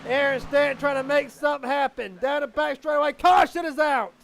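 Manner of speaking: urgent race announcer calling every move; excited race play-by-play. Real, not acted. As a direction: drive the commentary up to shouted peaks.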